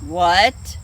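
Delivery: low, loud bear growl. Acted. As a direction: bark it from deep down. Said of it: "growls the brown bear".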